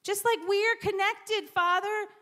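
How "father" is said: normal talking voice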